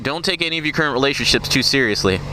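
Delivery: ordinary talk, nothing marked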